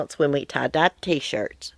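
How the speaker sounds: ordinary talk, nothing marked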